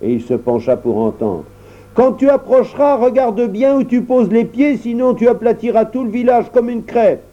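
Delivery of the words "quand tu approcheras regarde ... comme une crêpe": much higher little dwarf voice warning; the dwarf warns the giant about the village, much higher. Acted. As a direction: warn the giant, high and small.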